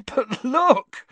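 high amused burst, half laughing. A narrator's reading. Ron pointing at the timetable, laughing.

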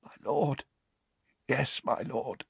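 hoarse, relieved gasp; hoarse with relief. A narrator's reading. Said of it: "his voice hoarse with relief".

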